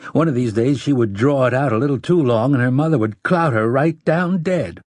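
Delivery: ordinary delivery, nothing marked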